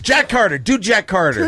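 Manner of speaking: high, loud, eager demand; calling out. Real, not acted. Host urging the next impression, calling out. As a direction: shout the request across the room.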